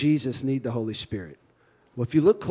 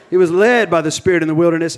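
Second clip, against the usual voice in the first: fast, louder Cajun accent; 3 semitones higher, 7 dB louder, faster than usual. Shown as a demonstration. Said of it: "I'm trying to shake my Cajun accent".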